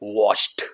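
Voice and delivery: वॉश्ड ending in a t sound; right pronunciation. Shown as a demonstration. Teacher gives the correct form, वॉश्ड ending in t. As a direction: say वॉश्ड as "washt". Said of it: "Effective and right pronunciation इसका होगा".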